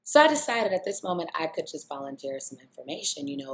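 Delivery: ordinary talk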